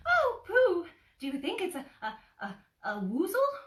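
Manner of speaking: high, loud, stammering piglet; squeaky and thrilled, tripping over the words; tiny piglet bursting with excitement; excited Piglet. Acted.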